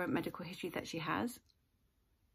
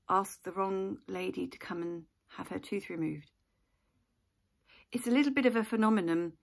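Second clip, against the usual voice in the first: usual speaking voice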